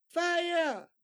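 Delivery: high, loud warning cry; alarmed shout. Shown as a demonstration. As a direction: yell it to warn everyone. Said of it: "to show strong feeling".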